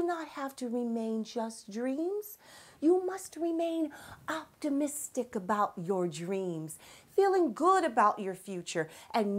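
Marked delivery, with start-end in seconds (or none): none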